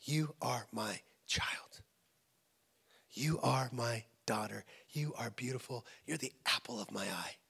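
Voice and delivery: low, soft, measured affirmation; hushed fatherly tenderness, word by word; God speaking tenderly. Acted. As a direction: speak it slowly as to a beloved child. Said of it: "he tells me who I am".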